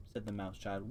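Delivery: ordinary delivery, nothing marked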